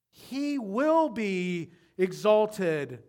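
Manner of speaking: "will" drawn out, very slow and loud; stress on will. Acted. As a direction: lean hard on "will". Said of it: "preaching emphasis".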